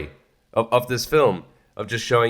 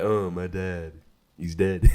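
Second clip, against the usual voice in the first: deadpan grief; 7 semitones lower, 4 dB quieter, slower than usual. Retold or imitated. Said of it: "and he's like".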